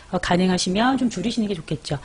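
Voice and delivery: ordinary talk, nothing marked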